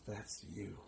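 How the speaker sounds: low, soft and slow, like a voice inside; hushed inner voice. Retold or imitated. Inner voice tells the narrator who he is, hushed. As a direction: speak it softly, as a voice only he hears. The voice after the win.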